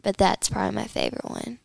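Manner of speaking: ordinary talk, nothing marked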